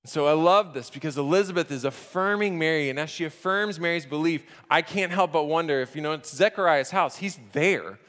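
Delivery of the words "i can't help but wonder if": ordinary talk, nothing marked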